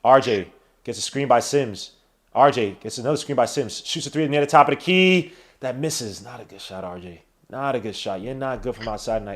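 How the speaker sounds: sports announcer calling the game; live play-by-play. Acted. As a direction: call it like a broadcast announcer.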